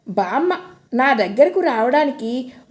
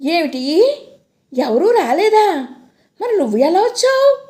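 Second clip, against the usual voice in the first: shocked; 5 semitones higher than usual. Acted. Grandma shocked girl came alone.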